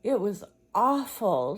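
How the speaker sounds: a loud, high mock wail. Sister wailing to Mama after her nightmare, loud and high. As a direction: cry it out like a hurt child. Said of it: "she wailed again".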